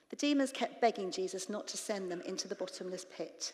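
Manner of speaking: ordinary delivery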